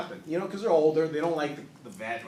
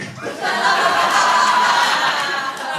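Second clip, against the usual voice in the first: laughing; 8 semitones higher, 8 dB louder than usual. Real, not acted. Crowd laughs at his joke.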